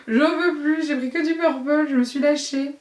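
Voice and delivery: high, laughing protest. Real, not acted. Vlogger admitting to viewers her purple spree, laughing. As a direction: crack up while confessing, pitched high. Cracks up over all the purple.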